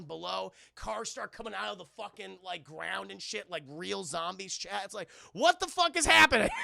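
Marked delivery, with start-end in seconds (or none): 5.3-6.5 high, very loud shout; imitated bystander screaming in alarm; mock panicked yell. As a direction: yell it in hammed-up disbelief. Voicing panicked bystanders in retelling.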